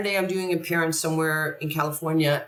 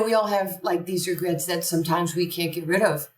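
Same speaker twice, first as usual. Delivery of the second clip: ordinary delivery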